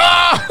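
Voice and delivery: retold panicked scream. Panicking as the lorry passes.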